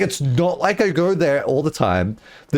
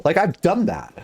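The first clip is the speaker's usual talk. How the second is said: ordinary talk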